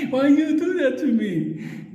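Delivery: high, slow desperate plea; pleading shopkeeper. Retold or imitated. Shop owner begs when he hears a motorcycle. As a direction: whimper it like he's terrified. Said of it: "when he hears a motorcycle".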